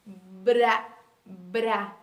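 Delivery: slow, careful "bra" with a rolled R; syllable "bra" said slowly and clearly; sounding out bra. Shown as a demonstration. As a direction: spell out the sound "bra" for children. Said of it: "Ó".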